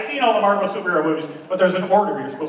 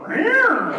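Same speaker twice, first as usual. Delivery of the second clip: high cat yowl, falling at the end; imitated high-pitched meow; cat sound; 14 semitones higher than usual. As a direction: screech like an annoyed cat. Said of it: "perfect point to go".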